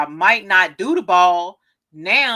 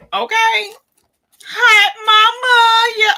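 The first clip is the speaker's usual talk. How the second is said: excited greeting; 10 dB louder, slower than usual. Real, not acted. Greets mother on camera.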